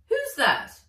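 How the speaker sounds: high, loud character voice; a sharp questioning voice; voiced character. Ivy questioning her informant, high and loud.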